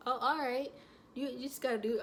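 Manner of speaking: trying to sound tough while about to cry; holding back tears. Retold or imitated. Mom telling the nurse to take her baby, near tears. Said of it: "I'm about to cry right now".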